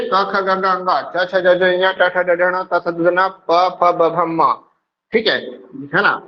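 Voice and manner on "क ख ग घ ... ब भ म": slow recitation. Shown as a demonstration. Teacher recites consonant series.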